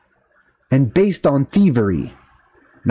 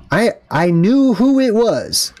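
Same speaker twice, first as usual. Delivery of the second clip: ordinary talk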